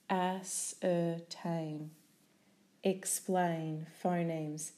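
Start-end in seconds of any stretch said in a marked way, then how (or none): 0.1-1.9 "Ascertain" slowly, syllable by syllable; split into syllables. Shown as a demonstration.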